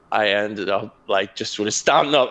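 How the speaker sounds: normal talking voice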